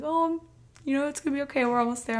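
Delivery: high, airy imitation of comforting friends; friends reassuring. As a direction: mimic the friends soothing her in the car. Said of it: "They're like".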